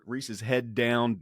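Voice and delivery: usual speaking voice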